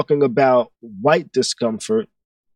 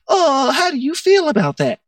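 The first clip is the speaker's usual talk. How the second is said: high, curious mock voice; imitating classmates; 10 semitones higher than usual. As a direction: pitch it up like a nosy classmate. Classmates look at her son.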